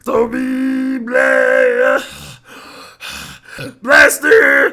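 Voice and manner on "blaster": booming low shout of the name; loud low blast. Acted. Streamer booming a name for the chat, loud and low. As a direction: belt it out deep and loud.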